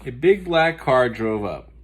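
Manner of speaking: ordinary delivery, nothing marked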